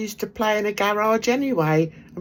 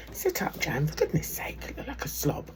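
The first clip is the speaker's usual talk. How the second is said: a quick whispered scolding, half to herself; whispered aside; 2 semitones lower, 9 dB quieter than usual. Real, not acted. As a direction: hiss it sideways at Jan, out of the viewer's earshot. Aside scolding Jan off-mic.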